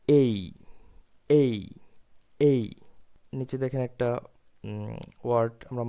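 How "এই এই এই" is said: এই said as a clear glide, eh-ee; এই, the English letter A, repeated slowly; এই stressed and drawn out like "ay"; correct letter A. Shown as a demonstration. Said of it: "এটা সঠিক উচ্চারণ হবে".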